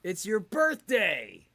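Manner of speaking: loud, high, drawn-out cheer; gleeful announcement. Real, not acted. Co-host announcing the birthday despite warning, loud glee. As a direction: shout it out to embarrass him.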